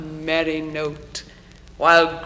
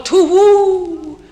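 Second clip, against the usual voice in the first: owl's song; 12 semitones higher, 9 dB louder than usual. A narrator's reading. Poem's reader voices the staring owl at night, high singing hoot. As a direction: sing out the call like a hooting owl. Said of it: "then nightly sings the staring owl".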